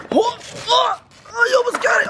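high shouting cheer; excited shout. Real, not acted. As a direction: yell it out thrilled. Friend nearly lands trick.

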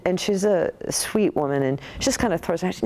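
usual speaking voice

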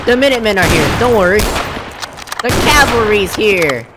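militiaman sounding the alarm, then reassuring; loud, high call settling into heroic assurance; Preston Garvey. Acted. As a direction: arrive as the rescuing cavalry. Plays Preston Garvey to the rescue.

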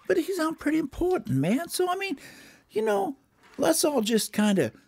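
high-pitched, casual streetwise bird; high cartoon bird voice; Mexican bird character.

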